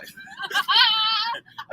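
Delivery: helpless laugh at the rain-soaked driver; burst of laughter. Real, not acted. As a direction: crack up at the driver.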